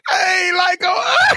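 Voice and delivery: high, squealing through laughter; cracking up while trying to ask; can barely get it out for laughing; laughing hard. Real, not acted. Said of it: "Stop laughing".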